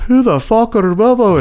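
high-pitched fighter's trash talk; Conor McGregor. Retold or imitated. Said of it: "Conor McGregor".

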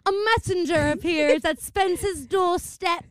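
put-on high, slow old-timey proclamation; hammed-up period-drama narration; theatrical herald. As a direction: announce it grandly like a stage herald. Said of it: "Why are you talking like that?".

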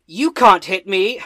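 loud, high, slow taunt; drawn-out cocky jeer. Acted. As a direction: taunt the monster, stretching each word. I'm gonna say.

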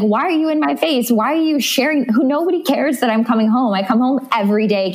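high-pitched mock exasperation; playful retold complaint, high and fussed; flustered. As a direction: imitate a flustered partner protesting, pitched well up. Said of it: "he would always get so flustered".

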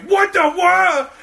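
very high, loud, drawn-out shout; retold shriek, slow and loud; startled yell. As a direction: imitate someone screaming in shock. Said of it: "So, so loud that she scares".

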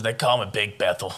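low boastful brag; deep macho voice. Retold or imitated. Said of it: "do you think that Brian goes".

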